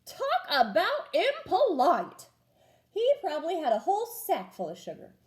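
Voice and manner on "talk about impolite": indignant. A narrator's reading. Wolf indignant at pig.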